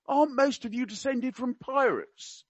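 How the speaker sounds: high-pitched mock-Cockney question; attempted Cockney. Quoting the prince to an islander, in attempted Cockney. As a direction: ask it high, trying for Cockney. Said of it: "I can't do Cockney. I will try my best".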